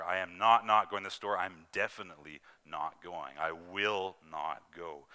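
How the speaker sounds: a firm no that will not budge; emphatic refusal. Shown as a demonstration. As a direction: refuse flatly, leaning on every "not". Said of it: "it's actually a completely emphatic answer".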